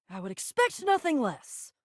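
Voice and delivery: ordinary talk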